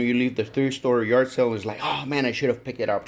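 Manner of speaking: usual speaking voice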